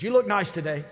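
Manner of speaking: laughing imitation. Son greeting pastor at the urinal, laughing and loud. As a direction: mimic the son's cheerful greeting while laughing. Son surprises him in the restroom.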